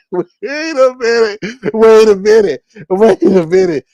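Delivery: high, loud retold protest; incredulous objection. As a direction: cut in with mock disbelief. Retells own objection, repeated for effect.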